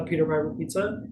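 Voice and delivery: ordinary talk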